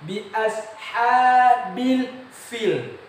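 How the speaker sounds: ashabil stretched long and high, FIL with f; slow, loud chanted bi ashabil fil with airy f; correct recitation. Shown as a demonstration. Teacher correcting pil to fil, careful recitation.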